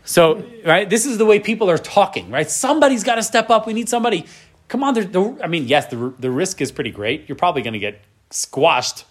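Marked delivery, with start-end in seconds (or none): 2.5-5.4 soldiers urging. Retold or imitated. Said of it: "That's how they're talking".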